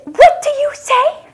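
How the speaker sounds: high, loud mock outrage; angry demand. People scolding someone who burped, mock angry. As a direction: act offended and demand an apology. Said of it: "People will become visibly angry at you".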